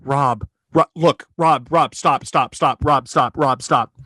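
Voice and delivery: low, urgent repeated calls. Retold or imitated. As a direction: imitate a held-back, insistent warning. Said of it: "louder, but also without, without shout".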